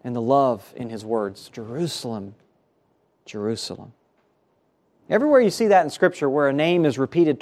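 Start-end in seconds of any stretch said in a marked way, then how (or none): 1.5-3.9 soft, hushed grieving lament. Retold or imitated. Jesus weeping over Jerusalem, softly grieving. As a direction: let the love and grief sink into the name. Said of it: "You can almost hear the grief".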